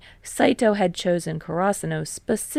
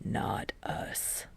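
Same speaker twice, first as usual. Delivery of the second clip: low breathy whisper, firm; hushed, stubborn repeat; 14 dB quieter than usual. Acted. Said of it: "Kageyama repeats".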